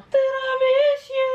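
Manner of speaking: Tiramisu! drawn out high and loud in delight; excited cry. Real, not acted. Vlogger crying out the ice cream flavor, thrilled. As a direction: shout the flavor in glee.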